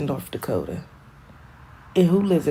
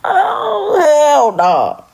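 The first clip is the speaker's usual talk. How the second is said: much higher, much louder wail of outrage; outraged cry; 12 semitones higher, 9 dB louder, much slower than usual. Real, not acted. A vlogger picturing recapture into slavery, outraged cry. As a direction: cry out at the injustice. Imagining recapture after escape.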